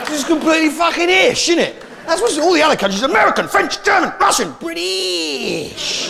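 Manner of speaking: high-pitched exasperated rant; comic ranting. Acted. Comedian ranting to the crowd about his country's name, high exasperated rant.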